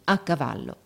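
ordinary delivery, nothing marked

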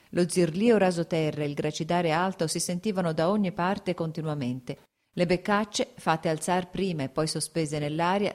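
ordinary delivery, nothing marked

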